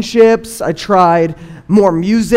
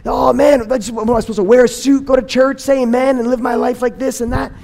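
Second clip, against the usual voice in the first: higher-pitched, exasperated rant; frustrated teen venting; 5 semitones higher than usual. Retold or imitated. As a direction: dump your complaints on him, fed up. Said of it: "I would just unload".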